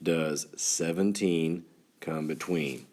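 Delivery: slow, deliberate classroom question; slow teacher question. Shown as a demonstration. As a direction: spell it out slowly for the class. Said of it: "this is the language that we use".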